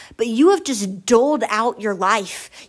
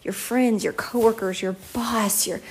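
usual speaking voice

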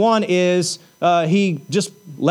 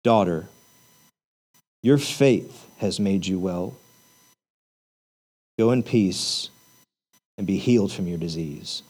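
tender blessing; 11 semitones lower, 5 dB quieter than usual. Acted. Jesus sending the healed woman home, soft tender blessing. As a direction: bless her quietly, like a father.

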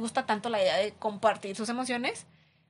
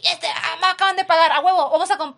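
loud giddy rush with laughter; euphoric glee; 5 semitones higher, 9 dB louder, faster than usual. Retold or imitated. Someone who just got paid, gleefully shouting about spending it. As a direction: hype yourself up over payday money.

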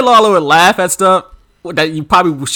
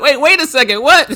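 confused surprise; 8 semitones higher, 3 dB louder than usual. Retold or imitated. Voicing the King confused.

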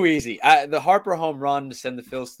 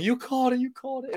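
a mild mock yell, much higher; retold yell; 11 semitones higher than usual. Cheering at his brother who predicted the home run, a mild mock yell. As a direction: replay the cheer at the brother, pitched way up. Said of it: "me yelling".

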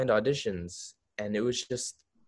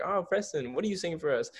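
higher-pitched, sweetly interested imitation; lightly voiced agent talking to a young hopeful; gushing casting agent; 7 semitones higher, faster than usual. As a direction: coo the name high, then ask. Acting out casting agent addressing him.

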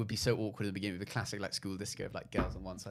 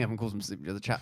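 usual speaking voice

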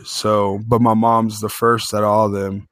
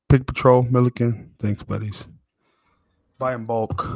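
ordinary talk, nothing marked

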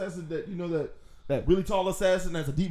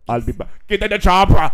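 Terminator impression; 3 semitones higher, 8 dB louder than usual. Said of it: "Now you sound like the damn Terminator".

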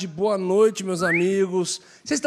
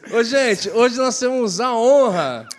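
pompous announcer; 7 semitones higher, 5 dB louder, slower than usual. Pretend. Mock grand introduction of guest.

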